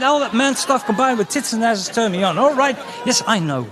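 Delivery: high, loud lusty gushing; mock aroused fanboy. Comic mocking his own lust at a video, high and loud. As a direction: gush like a turned-on fan. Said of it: "And it's".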